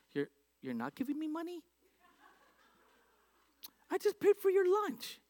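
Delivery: high, squeaky incredulous outburst; shocked disbelief. Retold or imitated. Pastor retelling his own reaction to the donor, in high mock shock. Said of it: "I was shocked".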